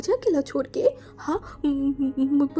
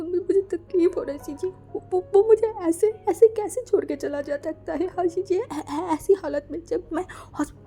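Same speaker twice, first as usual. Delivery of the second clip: higher, louder stammering sobs; crying; 4 semitones higher, 3 dB louder than usual. Acted. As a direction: wail through tears. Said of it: "wing रोते रोते बोला". (रोते रोते ऊँची टूटी आवाज़)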